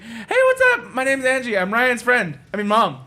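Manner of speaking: high, bright mock mom voice; mom introducing herself. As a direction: squeak it out like a cheery mom. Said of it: "It's like".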